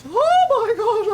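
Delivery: usual speaking voice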